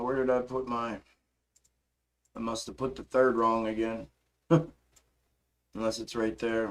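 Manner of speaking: ordinary talk, nothing marked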